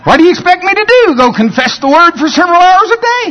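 high, incredulous mocking voice; mocking complainer. Preacher imitating a man complaining about confessing, high scornful voice. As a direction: throw his words back as a scornful whine. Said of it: "and he says".

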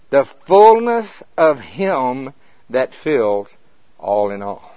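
slow, weighty, each word set apart; emphatic scripture reading. Acted. Preacher reading the verse to his church, slow and weighty. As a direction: lay down every word like a pronouncement.

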